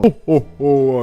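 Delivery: slow booming villain laugh; a big theatrical evil laugh; unhinged villain. Shown as a demonstration. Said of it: "à quel moment je ferais ça en tant que méchant".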